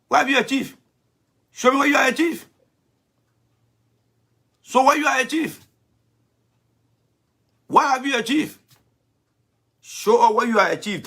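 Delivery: scornful demand. Real, not acted. Demanding Thomas show achievements.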